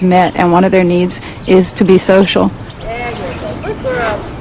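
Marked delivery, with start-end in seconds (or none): 2.5-4.3 praising an animal. Real, not acted. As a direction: coo at the elephant like a pet. Keeper praising an elephant.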